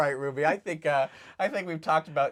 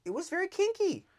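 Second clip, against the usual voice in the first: high teasing; 13 semitones higher than usual. Real, not acted. Juicy gossip.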